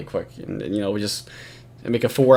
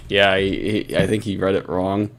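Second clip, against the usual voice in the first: usual speaking voice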